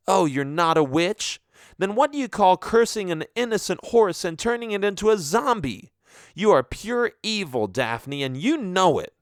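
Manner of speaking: mock her, then hit her with it; angry mocking shout. Diana calling Daphne pure evil, high angry sarcasm. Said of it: "shout accusations at each other".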